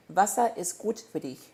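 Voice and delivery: Wasser with a V sound, ich-sound at the end of dich; German sentence. Shown as a demonstration. Teaching viewers a German phrase, careful German pronunciation. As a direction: say Wasser ist gut für dich the German way. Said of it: "if you wanna say it in German".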